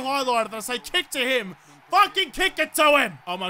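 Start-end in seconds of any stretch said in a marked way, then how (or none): 1.9-3.2 louder, forceful outburst; angry yell. Real, not acted. Gamer repeating his ignored kick command, angry yell. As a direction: yell the order at a deaf teammate.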